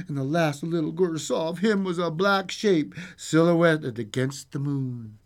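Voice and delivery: lively storyteller with swooping pitch; storybook reading, animated; fairy-tale narration. A narrator's reading. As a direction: tell a bedtime tale with suspense. Reads fairy tale aloud.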